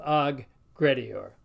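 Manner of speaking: Aggredior slowly, split as "ag... gredior"; drawn-out Aggredior with two distinct hard G's; both G's separated. Shown as a demonstration. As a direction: say Aggredior slowly, sounding each G. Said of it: "we've got 'em both".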